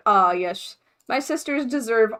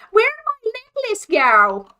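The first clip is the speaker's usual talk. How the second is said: hammed-up high Australian accent, loud; a cheesy Aussie line done badly; mock Australian accent from H2O; 13 semitones higher, 7 dB louder than usual. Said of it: "That was a horrible, horrible Australian accent".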